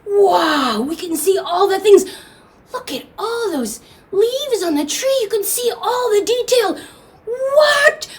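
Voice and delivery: high-pitched, marveling exclamations; amazed. Acted. Grandfather seeing everything in the new light, high astonishment.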